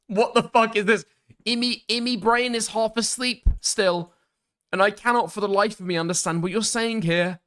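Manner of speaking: high-pitched, baffled exclaiming. Real, not acted. Streamer failing to understand a message, high baffled voice.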